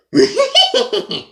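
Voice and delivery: high wheezy cartoon dog snicker; Scooby-Doo laugh. Shown as a demonstration. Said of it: "Scooby's laugh is more easier".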